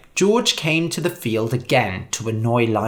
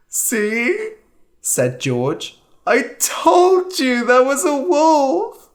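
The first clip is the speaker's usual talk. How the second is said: put-on vindicated boy; 12 semitones higher, slower than usual. A narrator's reading. George vindicated.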